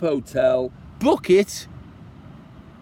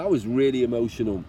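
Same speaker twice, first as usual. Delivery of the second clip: ordinary talk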